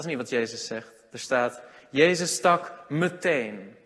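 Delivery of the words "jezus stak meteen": slow emphatic. Shown as a demonstration. Preacher stresses meteen to his listeners, slow and weighty. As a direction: slowly stress meteen, word by word. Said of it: "ik hoop dat je dit woordje leest, meteen".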